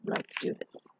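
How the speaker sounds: conspiratorial whisper. Acted. Inviting the children in, whispered excitement. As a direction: lean in and whisper it.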